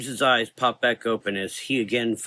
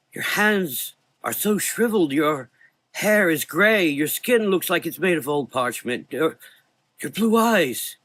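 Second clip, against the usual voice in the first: high, louder horrified voice; shocked; 8 semitones higher, 4 dB louder than usual. A narrator's reading. Frank shocked at his friend's withered face, high and loud. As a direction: recoil at what you see.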